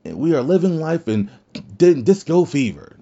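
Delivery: lightly mock coworkers pretending all is fine; mock cheery. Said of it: "You guys just come out and say".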